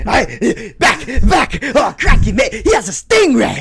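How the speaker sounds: high, loud hammed-up fright; mock panic. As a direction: back away squealing in a jokey Aussie panic. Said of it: "you're like".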